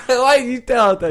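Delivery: loud, high protest through laughter; real helpless laughing objection, drawn out. Real, not acted. Laughing at the butchered joke.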